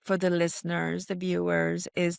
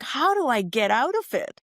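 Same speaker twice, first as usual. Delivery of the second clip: higher, mildly acted frustration; a touch of exasperation, pitched up; totally frustrated; 5 semitones higher than usual. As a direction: imitate your own frustrated question. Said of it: "when I'm totally frustrated".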